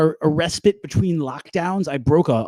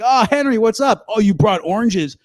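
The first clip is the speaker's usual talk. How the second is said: high, louder welcome to a guest; mock delighted greeting; 5 semitones higher, 4 dB louder than usual. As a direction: greet a friend at the door, hammed up. Acts greeting a guest at door.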